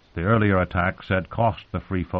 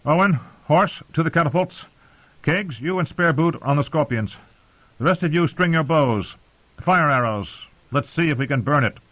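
raised, high-pitched battle orders; commanding; 7 semitones higher than usual. A narrator's reading. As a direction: call out orders to your men.

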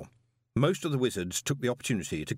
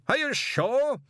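demanding; 4 dB louder, slower than usual. A narrator's reading.

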